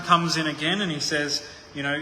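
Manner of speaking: ordinary delivery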